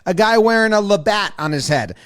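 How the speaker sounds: ordinary delivery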